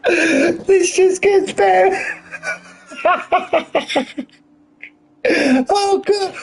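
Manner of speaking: giggling through the words, high-pitched; can't stop laughing mid-sentence. Real, not acted. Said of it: "I gotta get myself composed".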